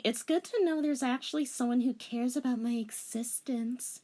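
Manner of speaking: high melodramatic sigh; melodramatic anime girl. Acted. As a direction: play up the heroine's hurt. Girl character.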